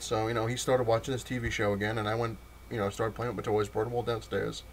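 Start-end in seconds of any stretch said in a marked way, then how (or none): none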